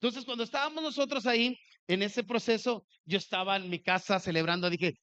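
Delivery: ordinary delivery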